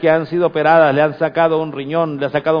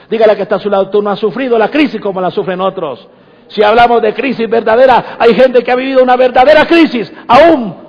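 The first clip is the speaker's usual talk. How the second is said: louder, raised pulpit exhortation; higher and louder sermon build; fervent preaching; 7 semitones higher, 7 dB louder than usual. Acted. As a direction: push the point hard at the congregation. Preacher exhorts congregation loudly.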